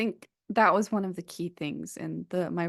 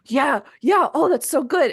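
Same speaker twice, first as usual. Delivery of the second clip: excited quote; 7 semitones higher, 9 dB louder than usual. Retold or imitated. Group members' excited reaction quoted.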